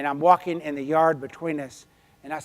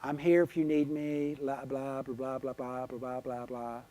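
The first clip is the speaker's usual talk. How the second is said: monotone blah-blah, sinking softer; mock text readout; 4 dB quieter than usual. Speaker mocks her own text to a grieving friend, dismissive monotone. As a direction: rattle off empty comfort, letting it fade away.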